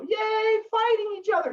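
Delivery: very high squeaky mock cheer; mock cheering crowd. Townspeople cheering about fighting, high squeaky mock cheer. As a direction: cheer cluelessly like a dim crowd. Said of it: "They go".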